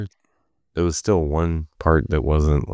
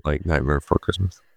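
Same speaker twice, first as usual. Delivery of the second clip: ordinary talk, nothing marked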